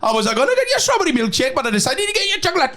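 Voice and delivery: very high, lilting mock Geordie; badly done sing-song Geordie that drifts towards Indian; wayward Geordie accent. Remembering [name] and [name], high botched Geordie accent. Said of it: "the only Newcastle one".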